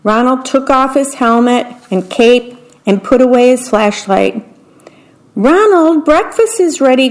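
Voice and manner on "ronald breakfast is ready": high, carrying call from the kitchen; Mama calling her son to breakfast from another room. A narrator's reading. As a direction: raise the voice to reach upstairs. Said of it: "called Mama from the kitchen".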